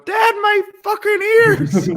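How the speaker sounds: put-on very high, slow shriek of a shocked kid. A kid complaining to dad about the swearing, shrieked high. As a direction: squeal like a child whose ears are burning.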